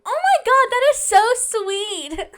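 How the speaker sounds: high squeal, delighted; gush, way up high. Real, not acted. Delighted by fortune.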